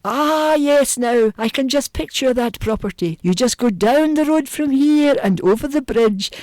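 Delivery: high, lilting Highland local; Highland accent. Retold or imitated. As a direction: give directions like a leisurely Highlander. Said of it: "somewhere up in the Highlands".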